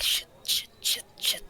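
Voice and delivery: hushed, whispered cursing under threat; frantic little whispered swearing; panicked whisper. Acted. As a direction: whisper it urgently in short bursts. Game master plays panicking Tarina hiding.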